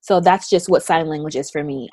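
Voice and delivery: ordinary delivery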